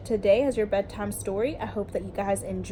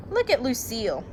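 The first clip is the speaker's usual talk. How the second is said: ordinary delivery, nothing marked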